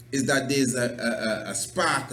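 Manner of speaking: normal talking voice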